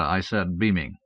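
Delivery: ordinary delivery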